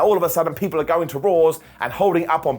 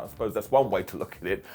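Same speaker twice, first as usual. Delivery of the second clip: dry quote; 7 semitones lower, 5 dB quieter than usual. Retold or imitated. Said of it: "I was like".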